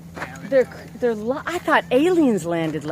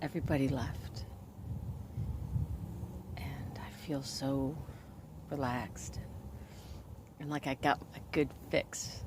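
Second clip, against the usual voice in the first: soft whisper; 7 semitones lower, 15 dB quieter than usual. Real, not acted. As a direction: whisper softly as if not to wake anyone. Quiet late-night whispered vlog talk.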